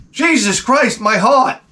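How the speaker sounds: high-pitched, hammed-up shock; mock fright. Rider after a haunted house jump scare, mock fright. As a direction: cry out as if startled.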